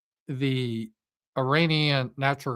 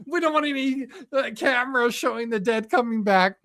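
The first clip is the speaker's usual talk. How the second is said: higher, mocking voice; mock Trump; 8 semitones higher than usual. Trump hiding the war dead, mocking impression. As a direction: sneer it as Trump. Imitating Trump on banned cameras.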